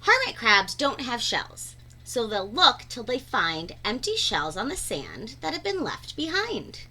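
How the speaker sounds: usual speaking voice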